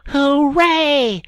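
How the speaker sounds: high, loud joyful whoop; excited cry. Acted. Rat crying out to Mole, thrilled. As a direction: Rat bursting out with glee. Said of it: "Suddenly the Rat cried".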